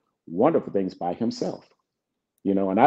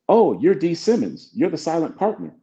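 higher-pitched voice of dawning recognition; retold recognition; 8 semitones higher than usual. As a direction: imitate someone suddenly placing a name. Stranger recognises him in retold meeting.

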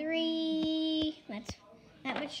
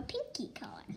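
whispering; 3 semitones lower, 10 dB quieter than usual. Real, not acted. Aside while mixing.

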